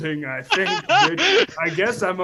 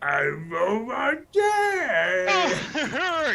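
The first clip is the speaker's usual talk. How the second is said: drawn-out, higher mock-Cockney holler; incomprehensibly thick Cockney; 6 semitones higher, much slower than usual. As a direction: wail it slowly in garbled Cockney. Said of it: "so thick we don't know what you're saying".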